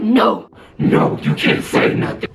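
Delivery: rasped, unvoiced possessed growl; imitating his scratchy demon voice. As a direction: snarl it like a demon cutting her off. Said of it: "that's how his voice sounded like when he was mad".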